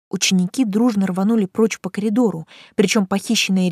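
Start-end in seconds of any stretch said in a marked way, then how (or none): none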